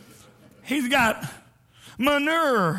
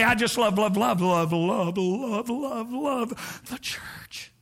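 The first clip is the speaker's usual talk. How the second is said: mocking churchgoer; slower than usual. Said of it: "I've heard some in the church say".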